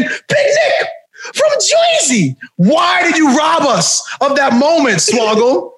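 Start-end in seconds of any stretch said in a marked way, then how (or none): 0.0-2.4 high, drawn-out Jersey shout; hammed-up heel reveal; Big Nick. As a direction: announce yourself like a Jersey loudmouth. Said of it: "if the payoff could have been".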